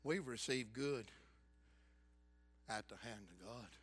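very soft, low, gentle; tender. Retold or imitated. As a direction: say it softly to comfort her. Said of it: "Job tenderly, compassionately".

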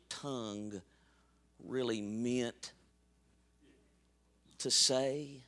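very soft, low plea to the Father; gentle intercession. Acted. Advocate explaining a sinner to the Father, gentle.

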